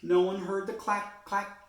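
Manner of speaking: usual speaking voice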